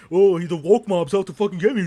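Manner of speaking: loud, high sneering whine of a smug athlete; mock him as a whiny, self-pitying diva; a sports fan mocking a star who complains of persecution, loud sneering parody; mocking Aaron Rodgers. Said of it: "fucking bragging about how fucking".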